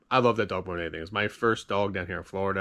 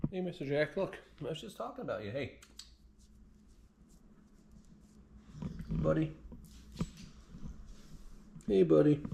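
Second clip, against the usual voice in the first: talking to a pet; 5 semitones higher, 11 dB quieter than usual. Real, not acted. Talking softly to his old dog.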